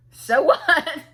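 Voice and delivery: high, loud mock exclamation; retold dismissive retort. Answering the girls' threat, loud dismissive retort. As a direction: throw it back loudly, half laughing. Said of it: "I'm like".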